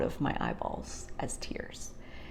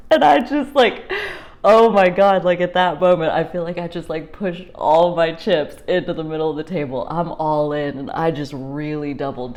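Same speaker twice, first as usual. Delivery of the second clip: suddenly loud and exhilarated; excited; 10 dB louder than usual. Real, not acted. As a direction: burst out, thrilled. Excited outburst after the whisper.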